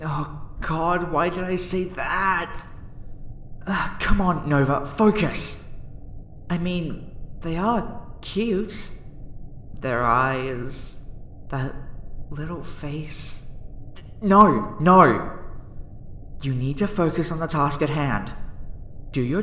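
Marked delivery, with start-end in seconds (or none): 0.0-5.7 exasperated self-scolding. Acted. Researcher scolding self for calling the subject cute, exasperated. 13.9-15.4 sudden loud double no; firm self-rebuke. Acted. Researcher stopping self from getting attached, emphatic.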